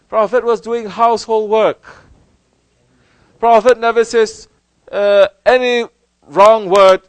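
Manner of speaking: loud, high impassioned sermon; preaching at full force. Acted. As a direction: preach it out to the crowd. Preaching with raised voice.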